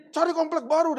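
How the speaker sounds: high, loud retold outburst; resentful outburst. Resentful mother telling her family to move house, indignant outburst. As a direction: act out a mother's indignant demand. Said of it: "mama saya tuh dendam sekali".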